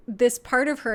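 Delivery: ordinary delivery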